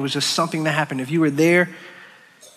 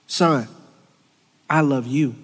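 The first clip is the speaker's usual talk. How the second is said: dad's gentle voice; 4 semitones lower than usual. Retold or imitated. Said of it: "hearing my dad say".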